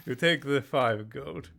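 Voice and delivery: normal talking voice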